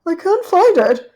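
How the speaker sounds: high, loud anxious cry. Acted. Duchess searching the pie dish, high anxious cry. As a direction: fret that it's gone. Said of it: "she said anxiously".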